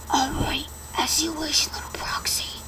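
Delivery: monster's whisper; demonic whisper. Acted. Slender Man grants the proxy's wish, whispered. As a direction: breathe it out like a demon.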